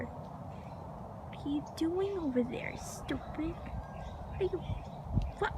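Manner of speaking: slow, very soft whisper. Real, not acted. Asking the pet what it's doing over there, whispering. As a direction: repeat it under your breath, drawn out. Question repeated under her breath.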